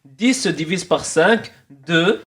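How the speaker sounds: put-on immigrant accent in French; thick immigrant accent in French; blédard accent. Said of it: "pourquoi j'ai pris un accent blédard".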